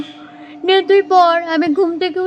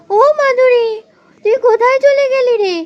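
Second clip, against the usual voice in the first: high, louder call across the field; 7 semitones higher, 4 dB louder than usual. Acted. Mother calls out for her missing cow, high and loud. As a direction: call the lost cow far off.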